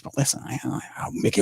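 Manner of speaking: hushed aside. Retold or imitated. Mock argument, hushed.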